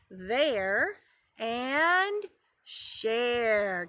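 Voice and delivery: "There" and "share" each drawn out; rhyme sounded out. Shown as a demonstration. Teacher asking preschoolers to hear rhyming, rhyme sounded out. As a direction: stretch "There", pause after "and", let "share" fall. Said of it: "did you hear that rhyming".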